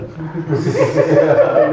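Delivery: a real, warm burst of laughter; amused laugh. Real, not acted. Teacher laughing at his own joke with students, amused laugh. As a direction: let the laugh roll out.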